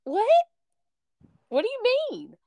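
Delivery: imitating her younger self, high and baffled; retold surprise. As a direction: act out a startled "what do you mean". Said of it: "And I'm like".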